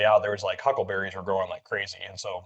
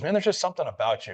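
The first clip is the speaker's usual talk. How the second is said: higher, lightly voiced new guy; imitating teammate; 8 semitones higher than usual. New guy pulling him aside, lightly voiced. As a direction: quote the new guy with a lifted voice.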